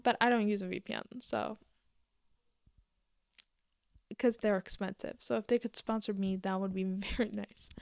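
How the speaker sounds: ordinary delivery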